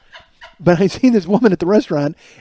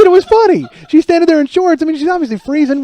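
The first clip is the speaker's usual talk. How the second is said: much higher and louder, indignant self-defense; defensive protest; 11 semitones higher, 5 dB louder than usual. Retold or imitated. Telling his wife why the joke was funny, high and indignant. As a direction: plead your case, worked up.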